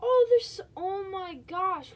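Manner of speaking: sudden loud, high shriek; excited shriek. Real, not acted. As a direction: blurt it out thrilled.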